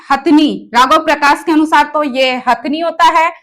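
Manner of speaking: usual speaking voice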